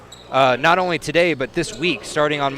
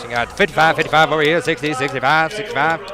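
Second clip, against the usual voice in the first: auctioneer voice. Retold or imitated. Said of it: "he's doing a great auctioneer voice".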